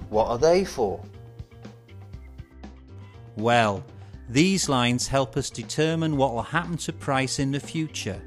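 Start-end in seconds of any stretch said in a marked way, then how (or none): none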